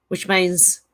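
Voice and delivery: ordinary delivery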